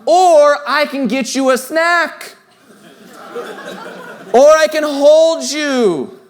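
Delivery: high, drawn-out, emphatic offer; parent to toddler. Acted. Parent to a three-year-old losing it, high and slow. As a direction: stretch it out high, as to a tantruming child.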